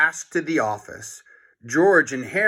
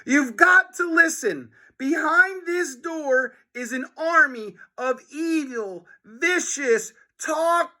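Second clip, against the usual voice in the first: put-on high, pleading boy; desperate high warning; urgent kid voice; 13 semitones higher than usual. A narrator's reading. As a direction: rush the warning out. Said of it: "cried George".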